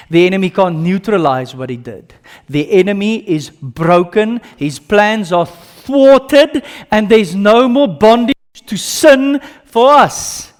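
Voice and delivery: rising from firm to loud, high and slow; building preaching. Acted. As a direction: build it to a triumphant shout.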